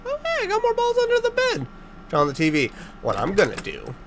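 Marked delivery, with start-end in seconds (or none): 0.0-1.7 way up high, delighted; excited. Real, not acted. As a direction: squeal at the find. Finds balls under bed.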